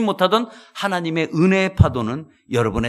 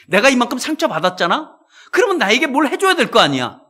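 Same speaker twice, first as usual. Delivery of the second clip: higher, louder aggrieved demand; demanding whine; 6 semitones higher, 4 dB louder than usual. Retold or imitated. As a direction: insist you're owed something. Quoting hurt person demanding payback. (보상을 요구하는 억울한 말투)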